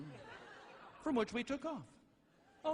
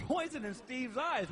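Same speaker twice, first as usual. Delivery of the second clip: ordinary talk, nothing marked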